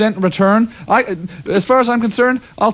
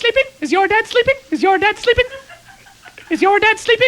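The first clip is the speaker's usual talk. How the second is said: mocking high voice; 11 semitones higher than usual.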